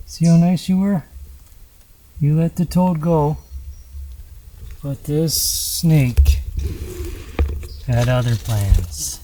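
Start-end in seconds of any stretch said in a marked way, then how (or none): 4.8-9.2 slow, lower, dramatic storyteller voice; ominous narration. Acted. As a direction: draw out the twist like a nature documentary.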